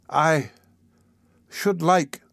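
high, halting small voice; hesitant child. Acted. The child begins his request to the beadle, hesitant. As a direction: pause timidly after the first word. Child falters a third time.

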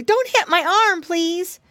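high, loud pleading piglet; Curly crying out. A narrator's reading. Piggy boy begging the snail, high alarmed plea. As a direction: plead in a high-pitched cry. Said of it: "cried Curly".

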